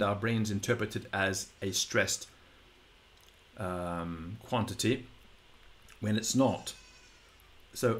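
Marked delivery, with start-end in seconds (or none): none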